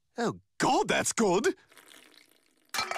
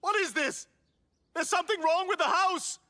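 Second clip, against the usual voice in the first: higher, alarmed cries; panicked; 9 semitones higher than usual. Acted. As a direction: panic about change. Panicked outburst.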